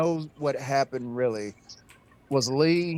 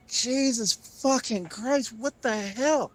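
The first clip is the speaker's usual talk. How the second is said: slow, much higher, disbelieving exclamation; shocked outburst; 8 semitones higher, slower than usual. Acted. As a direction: stretch it out in horrified disbelief. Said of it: "And he's just like".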